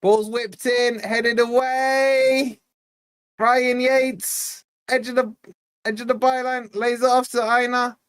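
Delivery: mock live football commentary, stretched out; play-by-play. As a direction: call each touch like live commentary. Calling the match like a commentator.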